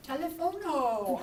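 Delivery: TELEPHONO with an O tacked on the end; fake foreign word. English speaker to deli man, mock-Spanish "Telephono". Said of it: "we say stupid stuff".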